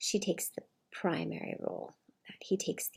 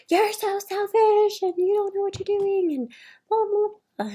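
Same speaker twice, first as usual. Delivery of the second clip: shrill, much higher and louder raging accusation; over-the-top rage; 13 semitones higher, 8 dB louder than usual. Retold or imitated. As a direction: explode at them over nothing, high and loud. Narcissistic rage over a sandwich.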